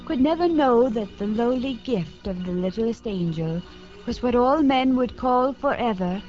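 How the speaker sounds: plain reading voice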